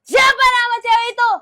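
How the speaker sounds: loud, demanding outburst; accusing yell; jealous shout. Acted. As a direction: demand an answer at full volume. Jealous confrontation.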